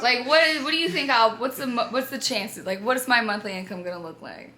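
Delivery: loud, rapid questioning; loud excited questions. Retold or imitated. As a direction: fire off the questions loudly. Excitedly voicing her own question.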